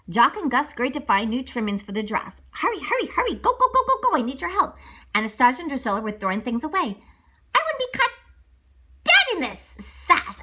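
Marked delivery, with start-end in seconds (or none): 2.5-4.8 high hurried mouse. Acted. Mouse urging others. 7.5-10.4 snooty. Acted. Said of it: "Anastasia said".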